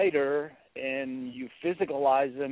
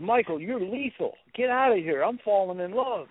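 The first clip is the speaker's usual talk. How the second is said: imitated smitten woman, higher pitched; swooning admirer; 7 semitones higher than usual. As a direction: play a woman protesting that she's falling for him. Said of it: "And she goes".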